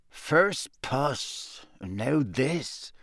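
grumbling aside to a friend; mutter. Acted. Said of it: "muttered Seamus".

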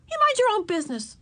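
very high, indignant retort; defiant retort. A narrator's reading. Ramona to prying Henry, defiant. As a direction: snap back in a high pitch. Hidden girl rebuffs nosy boy.

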